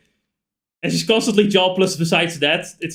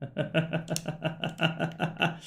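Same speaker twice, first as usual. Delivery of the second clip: soft, steady run of short chuckles; quiet chuckle; 13 dB quieter than usual. Real, not acted. Player laughing to self mid-game, soft staccato chuckle.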